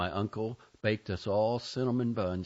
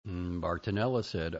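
ordinary delivery, nothing marked

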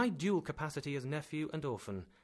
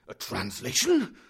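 loud, higher scornful tirade; animated professor; 4 semitones higher, 9 dB louder than usual. A narrator's reading. Professor scolding nephew about Icelandic, loud and scornful. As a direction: scold him with passionate contempt. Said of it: "the professor replied animatedly".

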